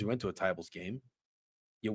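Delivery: ordinary talk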